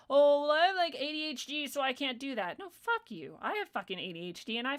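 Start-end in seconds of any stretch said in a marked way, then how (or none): 0.1-2.6 higher, loud whiny mock of an excuse. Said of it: "people were like".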